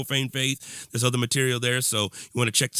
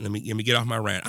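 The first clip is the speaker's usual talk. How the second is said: ordinary talk, nothing marked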